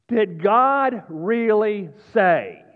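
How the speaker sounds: booming, drawn-out question from the pulpit; preacher's yell. Acted. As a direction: yell it one heavy word at a time. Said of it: "If I raise my voice".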